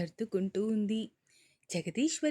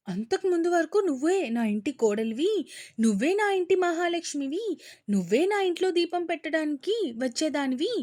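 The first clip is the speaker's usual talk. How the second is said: mock aunt's dialogue; 5 semitones higher, 4 dB louder than usual. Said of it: "బొచ్చుడు డైలాగులు కొట్టింది".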